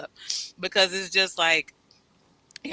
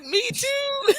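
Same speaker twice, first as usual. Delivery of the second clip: much higher, emotional; tearful squeal. Retold or imitated. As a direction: squeak it out, overcome. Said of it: "I'm like".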